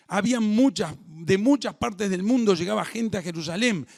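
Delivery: loud, high, fervent preaching; a preacher stirring up the congregation; preaching at full force. Acted. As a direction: build the sermon to a passionate peak.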